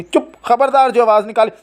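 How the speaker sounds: put-on high-pitched warning to a child; threatening scold. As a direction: order the child silent. Mother threatens crying child.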